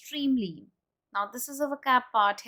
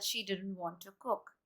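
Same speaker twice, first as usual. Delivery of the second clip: softer, sinking tune on "she didn't want to cook"; falling intonation; 11 dB quieter than usual. Shown as a demonstration. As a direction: let it fall away quietly to "cook". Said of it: "That's called falling intonation".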